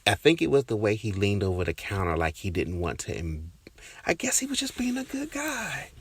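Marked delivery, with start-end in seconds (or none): 4.1-5.9 very high, thin self-mocking voice; mock falsetto. Telling listeners she's overreacting, in a very high mock voice. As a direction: jump way up and brush it off in a put-on voice. Talking herself out of feeling hurt.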